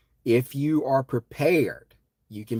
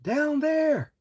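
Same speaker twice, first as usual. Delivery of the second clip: much higher calling shout; yelling down; 5 dB louder, much slower than usual. Retold or imitated. Warning people on the beach from a rooftop, yelling. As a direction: call down from a rooftop. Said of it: "I'm yelling".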